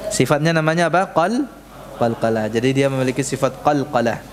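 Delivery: ordinary talk, nothing marked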